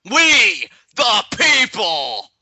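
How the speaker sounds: high, loud, drawn-out proclamation; stretched-out rallying cry; rallying catchphrase shout. Acted. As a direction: bellow it slowly like a wrestling slogan. Performs the wrestling team's catchphrase.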